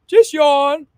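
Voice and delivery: big loud demo yawn through the words; loud demonstrative yawn. Shown as a demonstration. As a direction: open wide and yawn it out. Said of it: "I want you to yawn for me".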